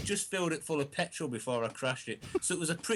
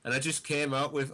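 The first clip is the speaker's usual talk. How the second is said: normal talking voice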